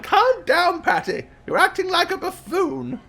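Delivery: high-pitched grandma reprimand; scolding grandmother. Acted. Grandma calming her shrieking granddaughter, scolding. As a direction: tell off an overexcited child.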